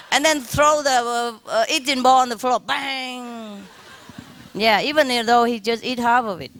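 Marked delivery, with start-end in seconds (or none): 2.6-3.6 drawn-out, softer high "bang"; imitated bang. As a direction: sound out a ball hitting the floor. Imitates ball hitting the floor.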